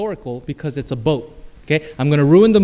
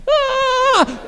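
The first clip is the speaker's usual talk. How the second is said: very high, held pretend squeal; comic sailor scream, pitched way up; replayed mock scream. Sailor replays his scene for everyone, mock scream. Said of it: "Let's watch it again".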